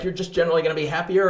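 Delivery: quick, high throwaway mock quote; imagined God, offhand. God promising Abraham something generic, offhand and rushed. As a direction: toss it off fast as a vague promise. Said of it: "Was God like".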